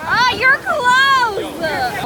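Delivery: high, loud, slow excited cry. Real, not acted. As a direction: cheer them on, thrilled. Friend nearly at the rock.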